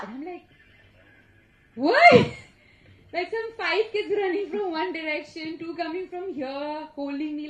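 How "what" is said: high, loud startled yelp. Retold or imitated. Her startled reply to the kids' shout, loud. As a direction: blurt it out loudly, taken aback.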